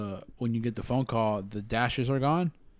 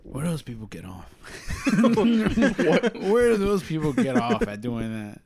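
mock outrage; 7 semitones higher, 6 dB louder, slower than usual. Mock outrage among friends.

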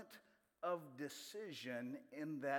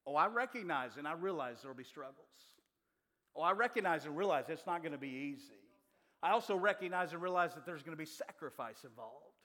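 a touch louder, building preach as Ruth's vow; rising preach; 2 semitones higher, 5 dB louder than usual. Acted. Preacher voicing Ruth's vow to the church, building louder. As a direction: press each realization harder.

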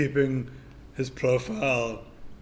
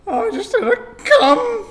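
much higher, loud, slow giggling voice; giggly squeak; 10 dB louder than usual. Acted. High laughing squeak.

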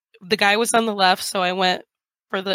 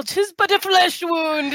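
high, drawn-out mock-heroic cry; stretched-out, high-pitched show of false cheer; mock bravado; 9 semitones higher than usual. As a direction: shrug off the injury with hammed-up bravado. Mock bravado about losing health.

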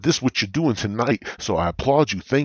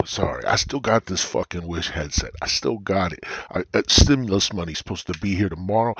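normal talking voice

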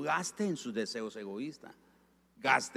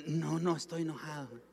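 higher-pitched pretence of not being angry; put-on innocent denial, pitched up; feigned calm denial; 4 semitones higher than usual. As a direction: act out covering up your anger. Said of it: "Y usted disimula".

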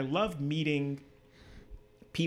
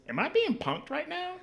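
much higher, mildly mocking confused voice; baffled question; 14 semitones higher than usual. Startled architect asking if he's being pranked, mock high voice. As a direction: lightly imitate a startled stranger.

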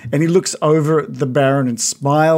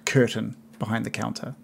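ordinary talk

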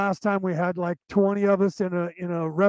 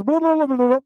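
high mocking blah-blah, a bit louder; mock babble; 6 semitones higher, 5 dB louder than usual. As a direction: mimic fast speech heard as gibberish. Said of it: "all they heard was".

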